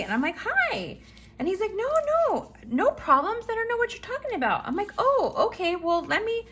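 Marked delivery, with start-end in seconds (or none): 0.4-1.0 sugary high hi, much higher; fake-sweet hi. Woman greets the neighbor she's confronting, fake-sweet high. 1.8-4.7 high, fast flustered no no no; flustered denial. Retold or imitated. Neighbor denies problems to the woman, high and rushed. 5.0-6.4 high, overly sweet okay; mock-friendly. Woman about to set the neighbor straight, fake-sweet. She mocks faux-friendly tone.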